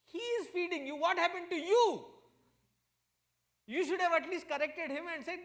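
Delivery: loud, high-pitched mock outrage; scolding wife. Wife complaining to Bhagwan about her foolish husband, loud acted scolding. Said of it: "she scolds both of them".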